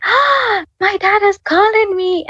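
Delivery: high, excited squeal on a gasp; breathless-with-joy, high-pitched retelling; genuinely excited. Retold or imitated. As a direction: relive the thrill of the call, pitch way up. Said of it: "genuinely, genuinely excited".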